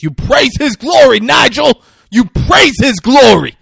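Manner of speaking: high, shouted preacher's command; hammed-up religious outburst; zealous rebuke. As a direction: bellow it like an outraged believer. Bible guy rebukes blasphemy.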